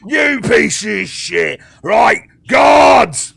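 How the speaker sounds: high, drawn-out enraged bellow; rage, then yell for backup; furious guard shouting. Acted. Guard with dirt in his eye, furious shout for guards. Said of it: "and he goes".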